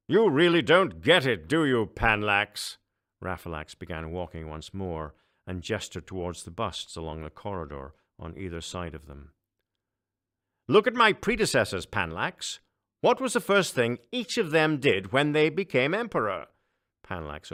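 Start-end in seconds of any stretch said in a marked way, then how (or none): 0.1-2.8 higher, louder scornful emperor, slowing at the end; a sneering ruler talking down. Acted. Emperor scowls at Panlax. 10.7-16.5 much higher, louder pompous emperor; a booming, self-important ruler. Acted. Emperor lectures.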